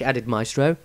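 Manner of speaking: ordinary delivery, nothing marked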